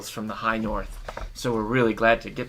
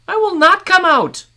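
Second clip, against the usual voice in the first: much higher, loud woman's voice; refusing woman; 11 dB louder than usual. Acted. Woman answering the man from inside, high stubborn refusal.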